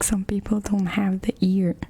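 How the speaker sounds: normal talking voice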